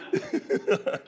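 breathy, wheezing chuckle; laugh. Real, not acted. Preacher after joking about conviction, soft breathy laugh. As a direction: laugh softly at your own joke.

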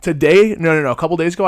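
ordinary talk, nothing marked

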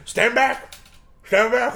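high, urgent mock call; mock warning shout. Trainee warding off attackers, high mock shout. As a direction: imitate someone ordering people to keep away.

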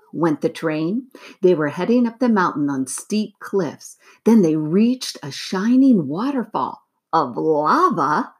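usual speaking voice

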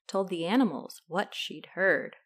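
ordinary delivery

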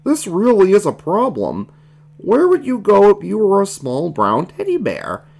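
high, swooping frog character voice; puzzled baby Kermit. Acted. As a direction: think aloud as a worried little frog.

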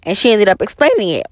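ordinary talk

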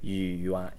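语 low, then 言 rising; third then second tone. Shown as a demonstration. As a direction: demonstrate 语言, low tone into a rise. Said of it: "the third tone and the second tone".

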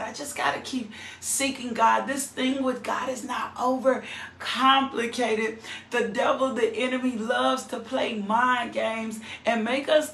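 loud, high preaching voice; fired-up preacher calling out; full-force preaching. Real, not acted. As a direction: preach it out to the congregation.

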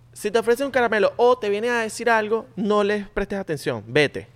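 high, put-on mother's warning; imitation of a mother laying down rules; mom voice. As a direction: warn like a mom, slowing for emphasis. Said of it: "esa voz de mamá".

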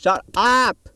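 high, loud exasperated shout; explosive scream, second word drawn out. Real, not acted. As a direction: yell it at the top of your voice. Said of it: "I'm literally screaming".

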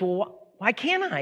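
high-pitched, stammering salesman; puzzled salesman. Acted. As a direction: push the question up high, baffled. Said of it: "there's a salesman on there".

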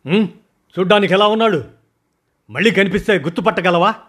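high, loud, fast interrogation; pressing questions. Acted. Boss presses his man to describe the stranger, high and loud. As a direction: press him hard for details.

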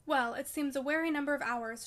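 plain reading voice

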